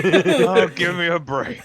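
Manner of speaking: loud, high exasperated groan. Real, not acted. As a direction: throw up your hands. Exasperated outburst.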